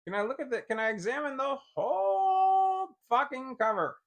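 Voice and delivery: real irritation spilling out; exasperated outburst. Real, not acted. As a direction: plead loudly with the software. Frustrated at the screen.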